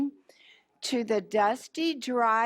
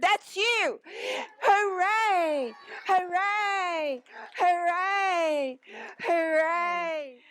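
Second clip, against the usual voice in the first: high excited hoorays; cheering animals; 6 semitones higher, slower than usual. Acted. Desert animals cheering tortoise, high excited hooray. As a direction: cheer like a crowd at the finish.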